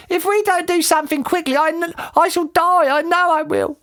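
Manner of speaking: high-pitched desperate wail; panicked scream. A narrator's reading. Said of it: "screamed Missus Twit".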